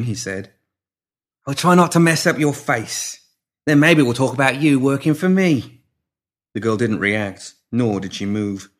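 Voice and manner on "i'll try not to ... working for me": menacing pimp. A narrator's reading. Pimp threatening the girl.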